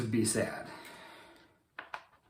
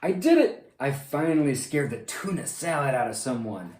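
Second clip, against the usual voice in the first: excited cheer; 3 semitones higher, 6 dB louder than usual. Acted. Monster celebrating scaring the boy, loud and gleeful. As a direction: gloat like you finally won. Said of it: "cheered Leonardo".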